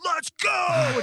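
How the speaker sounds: high shouted go; rally shout. Retold or imitated. Soldier leading a crowd of kids, high shout. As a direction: launch them forward.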